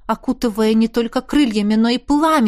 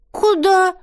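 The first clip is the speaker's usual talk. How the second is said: put-on child asking; 8 semitones higher, 3 dB louder than usual. A narrator's reading. Little girl asks mother.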